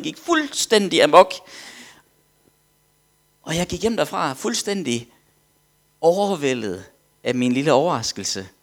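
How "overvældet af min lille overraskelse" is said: normal talking voice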